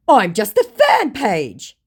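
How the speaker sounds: scammer voice. Retold or imitated. Scammer backpedals.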